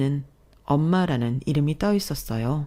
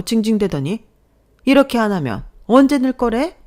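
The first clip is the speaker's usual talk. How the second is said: high, loud outraged mother; indignant mother-in-law raising her voice; loud scolding; 5 semitones higher, 5 dB louder than usual. A narrator's reading. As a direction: scold your son at full nagging pitch. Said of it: "어머니가 크게 소리치셨어요".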